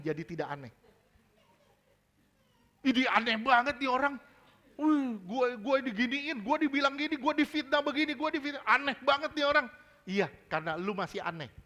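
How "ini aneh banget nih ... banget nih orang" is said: higher-pitched griping, piling up grievances; mock complaining. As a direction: imitate an indignant complainer airing grievances. Mimics someone complaining about others.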